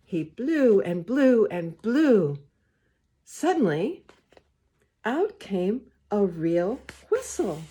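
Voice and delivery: put-on gentle read-aloud to children; story reading. A narrator's reading. Reader to the children, storytime voice. Reads picture book aloud.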